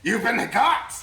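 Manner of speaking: loud gleeful laughing gotcha; prank reveal laughing. Real, not acted. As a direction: crow it out through laughter. GM reveals trick on players.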